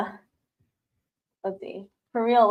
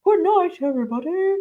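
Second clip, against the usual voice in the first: higher-pitched put-on pet voice; Marty; 7 semitones higher than usual. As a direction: voice the pet saying goodnight, pitched up. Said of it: "Marty, say goodnight to everybody".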